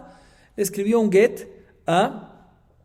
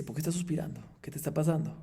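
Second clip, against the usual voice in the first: hushed, breathy wife's question; whispered question; 8 dB quieter, faster than usual. Retold or imitated. Wife to her sighing husband, whispered. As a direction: ask it under your breath.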